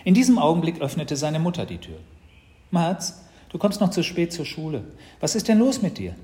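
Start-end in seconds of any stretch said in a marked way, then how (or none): 2.7-6.1 higher, louder, impatient questioning; suspicious mum at the door; scolding mother. Acted. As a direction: hurry your child along, growing louder. Mother scolds dawdling son.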